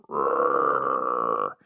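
low mock growl. Dog growling at his owner, low mock growl. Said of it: "He starts to growl".

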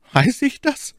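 very high, agitated maid's voice; aufgelöste Ketty. Acted. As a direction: throw it back in a high, distressed voice. Agitated maid answers in dialogue. (aufgeregte, sehr hohe Mädchenstimme)

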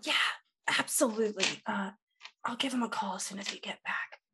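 hushed whisper. Acted. Partners whispering plans to each other, hushed. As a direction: whisper so the host can't hear.

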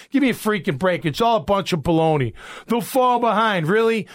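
usual speaking voice

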